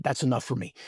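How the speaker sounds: quietly content, almost murmured; soft smiling reply. Retold or imitated. Son quietly accepting his mother's terms, softly smiling. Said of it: "looked at her and smiled".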